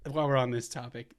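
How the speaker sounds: ordinary talk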